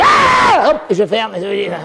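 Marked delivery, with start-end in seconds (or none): 0.0-0.7 high loud short yell; high sharp yell; yell into box. Acted. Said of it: "je crie".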